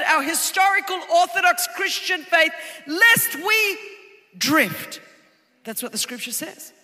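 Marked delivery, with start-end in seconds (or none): 2.9-5.0 pointed warning phrase; emphatic warning. Acted. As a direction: lift lest we, land drift hard. Sermon emphasis climax.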